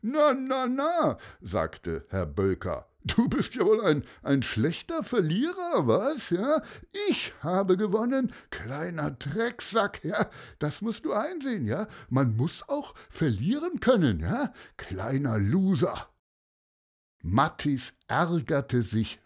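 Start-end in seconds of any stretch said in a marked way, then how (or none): 0.0-1.2 high-pitched tut-tutting; mock chiding. A narrator's reading. Winner rebukes the angry boy, mock chiding. 3.0-16.1 high-pitched, gloating needling; smug taunting. A narrator's reading. Cheating winner belittles the losing boy, smug taunting.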